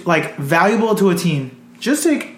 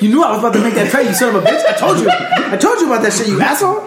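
mock angry rant; 4 semitones higher, faster than usual. Mock furious trade partner quote.

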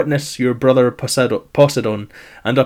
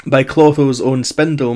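usual speaking voice

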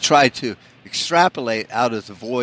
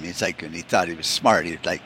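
ordinary talk

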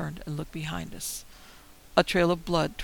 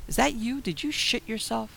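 half joking; 6 semitones higher, 3 dB louder than usual. A narrator's reading. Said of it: "Almost in jest".

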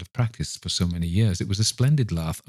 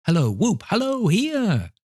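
giddy high-pitched calling out; joyful whooping; 13 semitones higher than usual. Acted. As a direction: whoop and halloo with sheer glee. Scrooge whooping for joy.

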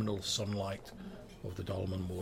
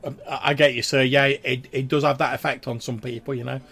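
loud, raised friendly barmaid; 4 semitones higher, 11 dB louder than usual. Acted. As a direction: reassure him loudly as the barmaid. Barmaid keeps talking.

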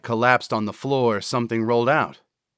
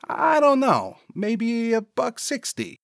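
dim sidekick pitched way up; high-voiced sidekick; 13 semitones higher than usual. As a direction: answer in a high, unsure character voice. Cecil's character voice in dialogue.

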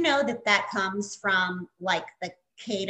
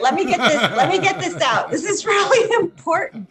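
laughing; 7 semitones higher, 6 dB louder, faster than usual. Real, not acted. Laughing while insisting.

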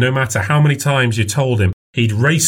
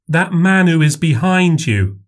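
put-on little girl voice; 7 semitones higher, slower than usual. A narrator's reading. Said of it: "child points out stranger".

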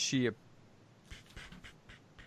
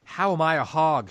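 loud, higher indignant demand; 6 semitones higher, 6 dB louder than usual. A narrator's reading. Mrs Turpin demanding of God, indignant and loud. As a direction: fling the question out at God. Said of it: "she demanded".

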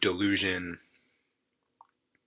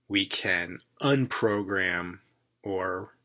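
ordinary talk

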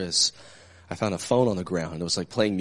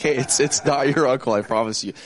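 louder, higher retold protest; insistent correction; 6 semitones higher, 9 dB louder than usual. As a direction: insist over a caller's mistake, half amused. Retells correcting the mistaken caller.

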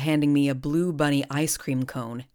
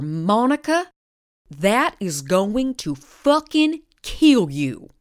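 angry warning; 6 semitones higher, 6 dB louder, much slower than usual. Acted. Bobby retelling her warning.

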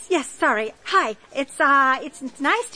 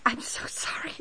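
whispered apology; 3 semitones lower, 9 dB quieter than usual. Acted. Blurting out an awkward pregnancy confession.

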